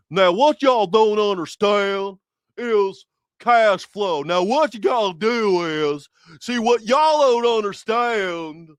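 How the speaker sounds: loud, high, slow Southern drawl; ex-father-in-law's Virginia drawl. Retold or imitated. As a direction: stretch it into a hammed-up Virginia twang. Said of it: "he would say shit like".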